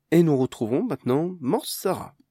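loud, bright TV presenter read; news anchor. Acted. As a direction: announce it like the morning news.

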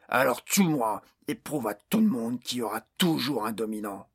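loud furious orc tirade; raging tirade. A narrator's reading. Orc boss daring Barnes to kill him, furious tirade. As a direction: hurl every word at him in fury.

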